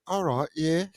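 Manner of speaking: mock East London disbelief; East London accent. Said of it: "she was like".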